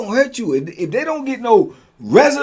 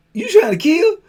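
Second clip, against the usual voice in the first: much higher, louder disbelieving question; incredulous; 12 semitones higher, 6 dB louder than usual. Retold or imitated. Storyteller questioning Vito, high and incredulous. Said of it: "I'm like".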